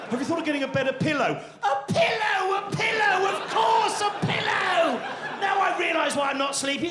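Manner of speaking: high, shouted sarcasm; sarcastic rant. Retold or imitated. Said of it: "sarcastic insomniac ranting back".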